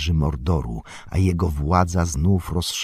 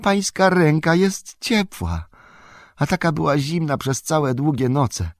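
pitched high with joyful amazement; excited exclamation; 9 semitones higher than usual. A narrator's reading. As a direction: marvel aloud in a raised voice. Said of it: "zawołał Sam".

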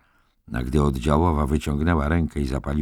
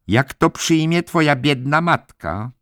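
high, raised, reproachful question; changed tone, guilt-tripping; 12 semitones higher, 5 dB louder than usual. A narrator's reading. Head nurse invoking Billy's mother, reproachfully concerned. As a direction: press on his guilt with pitched-up concern. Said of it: "Słyszałem zmianę w jej głosie".

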